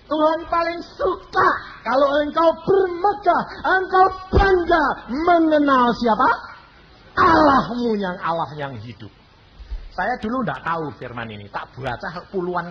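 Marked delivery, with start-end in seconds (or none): 0.1-9.1 loud, high exhortation; preaching at full force. Acted. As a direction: fire up the congregation. Preaching climax.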